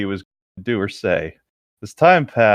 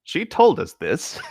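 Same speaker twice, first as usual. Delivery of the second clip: laughing shout; slower than usual. Real, not acted. Laughing at co-host's surprise.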